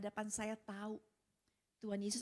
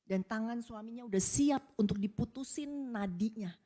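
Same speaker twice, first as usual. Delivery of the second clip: usual speaking voice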